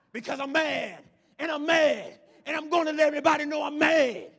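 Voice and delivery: acted gangster-rap rage, shouted; hammed-up angry yelling; mad. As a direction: shout it high and loud, hammering each phrase. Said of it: "if somebody make me mad".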